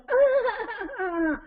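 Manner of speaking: high, wordless tantrum wail; mock frustrated yell. Puppet child's tower falls over, high mock frustrated yell. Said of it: "she starts yelling".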